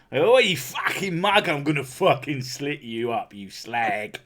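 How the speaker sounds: put-on London accent, acting furious; angry Londoner. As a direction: threaten like a riled-up Londoner. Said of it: "when I get angry, I turn into a Londoner".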